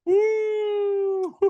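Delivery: high whooping cheer then a laugh; excited whoop. Real, not acted. Cheering his own pick on a podcast, excited whoop and laugh.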